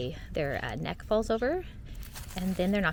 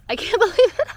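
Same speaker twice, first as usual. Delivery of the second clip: real laugh breaking through, much higher and louder; high, loud burst of amused disbelief; 11 semitones higher, 11 dB louder, faster than usual. Real, not acted. Gardener laughing at her own word slip, laughing in disbelief.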